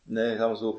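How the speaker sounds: normal talking voice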